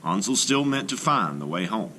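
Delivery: usual speaking voice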